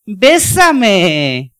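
loud parrot squawk, bésame rising each time. Acted. Parrot from an unchristian home squawking at its new owner, loud. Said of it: "la cotorra constantemente decía".

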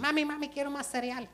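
put-on high, whiny kid begging mom; whining child. Pastor mimicking a child nagging mom for cereal, whiny high voice. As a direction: beg like a pestering little kid.

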